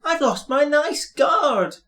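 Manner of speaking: high, wailing mock sob; upset coach sobbing for her guard. A narrator's reading. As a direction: wail it like a carriage in distress. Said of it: "she sobbed".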